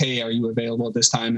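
usual speaking voice

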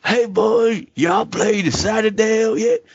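high, put-on country voice; Southern yokel. Local asking friends about the new map, high hammed-up Southern voice. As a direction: holler it like a mock Southern good ol' boy.

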